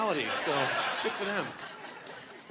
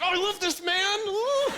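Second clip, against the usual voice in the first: high, gushing delighted squeal; delighted woman; 12 semitones higher, slower than usual. Retold or imitated. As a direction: swoon over him and squeal. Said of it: "You're just like".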